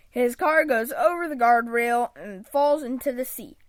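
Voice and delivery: ordinary delivery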